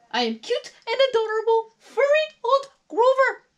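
very high, loud puppet monster; Grover. A narrator's reading. Furry monster introduces himself to Molly, in high character voice. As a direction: squeal a self-admiring introduction. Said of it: "said Grover".

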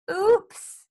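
high, loud little "oops"; Froggy's oops. A narrator's reading. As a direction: pipe up as a frog who slipped up. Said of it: "said Froggy".

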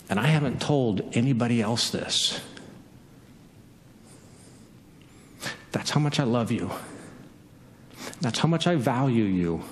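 low, gentle and sincere, with long pauses; hushed-toned reassurance, pitched well down; tender declaration. Acted. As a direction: reveal it intimately, letting each line settle. Jesus tenderly reveals himself.

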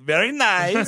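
mock European creep's accent; Borat-like accent. [name] photographing a girl, Borat-like accent. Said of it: "it's like a Borat type of amalgamation".

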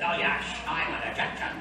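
mock Scottish film star claiming to be foreign; comic Scottish star impression, slushy s; Sean Connery. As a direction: keep the Scottish accent, "yes" as "yesh". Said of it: "when he was in The Highlander".

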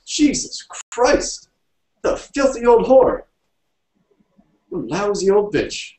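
high, outraged outburst; indignant cursing. A narrator's reading. Indignant cursing in acted dialogue.

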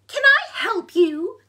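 high, loud little bird. A narrator's reading. Mother bird to staring bear, high-pitched.

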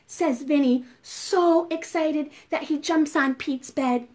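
ordinary delivery

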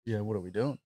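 a quiet, careless imitation of a teenager; bored teen mumble. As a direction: shrug it off like a bored fifteen-year-old. Bass quoted, bored teen.

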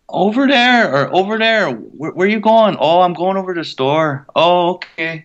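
breezy reservation-accent small talk with a friend; Res accent. Shown as a demonstration. Actor demonstrating a Native reservation accent. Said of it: "it's a Res accent".

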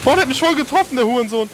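sudden high angry yell; angry outburst. Real, not acted. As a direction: curse out loud after being hit. Hit by enemy, cursing loudly.

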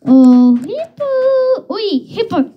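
high, squawky bird taunt calling out the hippo; macaw taunting. A narrator's reading. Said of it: "called out the hippo".

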